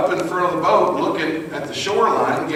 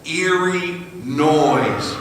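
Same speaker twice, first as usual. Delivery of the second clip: very slow, drawn-out words; eerie suspense; 3 semitones higher, much slower than usual. A narrator's reading. Storyteller describes the sound coming across the water, slow and ominous. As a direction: stretch it out spookily.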